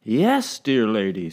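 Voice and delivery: captain joyfully defending his wheat; joyous. Acted. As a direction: praise bread with delight. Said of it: "said the captain joyously".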